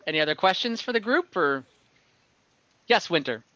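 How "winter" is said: usual speaking voice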